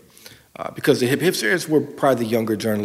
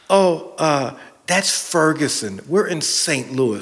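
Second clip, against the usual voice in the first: bored ironic hipster; high, slow, dismissive hipster drawl; 4 semitones higher, slower than usual. Retold or imitated. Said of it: "Is that a hipster voice?".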